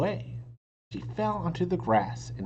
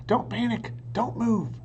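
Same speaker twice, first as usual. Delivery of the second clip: urgent warning; 10 semitones higher, 4 dB louder than usual. A narrator's reading. Jack calms Annie near dinosaur.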